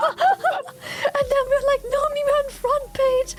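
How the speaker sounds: high, squeaky with laughter; cracking up mid-story; laughing while talking. Real, not acted. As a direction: tell it through giggles, pitch shooting up. Laughing through a funny anecdote.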